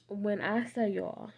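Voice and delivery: ordinary talk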